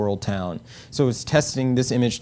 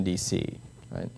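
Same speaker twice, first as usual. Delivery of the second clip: ordinary talk, nothing marked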